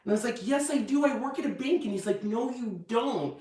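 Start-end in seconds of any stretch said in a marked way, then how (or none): 0.5-1.8 high-pitched protesting; mock insistence, pitched right up; insistent retort. Insistent retort in retold argument. 2.2-3.4 slow, drawn-out, higher and softer; stretched-out mock correction; patient contradiction. Husband's correction retold.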